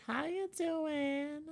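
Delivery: put-on high, slow girly voice, laughing; a guy squeaking out a sweet girl voice; man doing a woman's voice. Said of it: "Say it in your girl voice".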